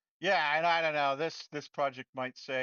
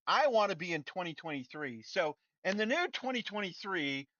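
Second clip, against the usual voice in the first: higher and louder, bossy and eager; excited CEO fantasy; 4 semitones higher, 8 dB louder than usual. Retold or imitated. Developer pretending to be Unity's CEO, excitedly bossy. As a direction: order the company about like a new boss. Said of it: "sorry, I'm getting all excited".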